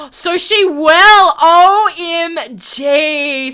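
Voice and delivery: loud, high, very drawn out; amazed exclamation. Pretend. Player learning she will play along, amazed. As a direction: stretch it out in hammed-up amazement.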